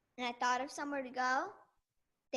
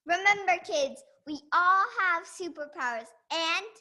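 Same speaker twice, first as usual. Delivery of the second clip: loud, higher-pitched rallying sign-off; slogan call ending slow and drawn out; show sign-off; 4 semitones higher, 8 dB louder, slower than usual. Acted. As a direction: announce it big, stretching the last words. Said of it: "join me in the sign off".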